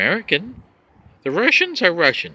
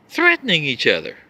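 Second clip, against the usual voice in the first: ordinary talk, nothing marked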